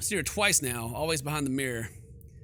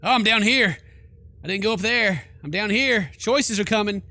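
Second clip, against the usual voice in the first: flustered exclaiming; 5 semitones higher, 8 dB louder than usual. Real, not acted. Player reacts to spooky game.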